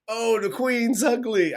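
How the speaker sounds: high, drawn-out mock British heckle; British accent. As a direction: taunt slowly in a put-on British accent. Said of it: "I'm doing British accent".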